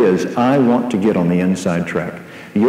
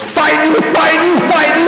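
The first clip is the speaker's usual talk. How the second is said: put-on much higher excited child voice; little boy's alarm; 3 dB louder than usual. Small son bursting in on yelling parents, alarmed high child cry. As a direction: play a four-year-old crying out in alarm. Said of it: "And he goes".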